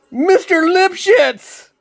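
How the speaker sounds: high, loud, slow call for the boss; shouting. Acted. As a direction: yell his boss's name across the shop. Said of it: "Rob shouted".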